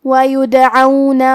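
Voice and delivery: وَيُدْعَوْنَ with a bouncing echo on the dal; وَيُدْعَوْنَ with a clear qalqala dal. Shown as a demonstration. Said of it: "دال کو ہلاتے ہوئے".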